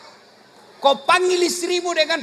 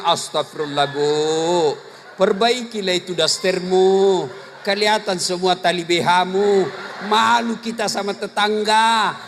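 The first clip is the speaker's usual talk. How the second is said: a lower husband scolding his wife; 6 semitones lower than usual. Retold or imitated. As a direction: play the embarrassed husband, voice dropped. Husband scolds wife, voiced low. (suami menegur istri soal daster robek, suara rendah)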